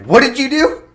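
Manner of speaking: alarmed question. Retold or imitated. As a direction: catch him mid-mistake, startled. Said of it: "was like".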